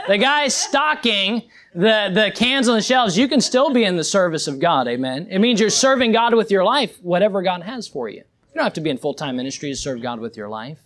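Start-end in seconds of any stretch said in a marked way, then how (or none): none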